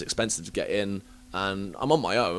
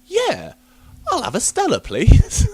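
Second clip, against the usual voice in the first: casual star reply; 9 dB louder, slower than usual. Retold or imitated. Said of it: "And he's like".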